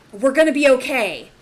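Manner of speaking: a desperate man calls out that they will be okay; masculine voice, loud reassurance. Acted. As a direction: shout the comfort as the man. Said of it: "the masculine voice is saying something".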